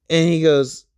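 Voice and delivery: ordinary delivery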